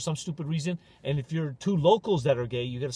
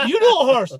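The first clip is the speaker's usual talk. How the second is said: mock challenge; 7 semitones higher, 10 dB louder than usual. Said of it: "Here's my horse impression".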